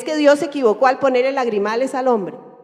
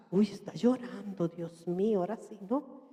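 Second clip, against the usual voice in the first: soft, low aside of dismay; hushed mock alarm; 3 semitones lower, 11 dB quieter than usual. Onlookers startled by a man crying, hushed mock alarm. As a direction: drop right down, as if scandalised.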